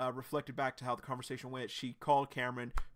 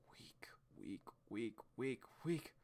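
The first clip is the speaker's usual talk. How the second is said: whisper; 2 semitones lower, 10 dB quieter, slower than usual. Acted. Echoing Blyke's self-hatred.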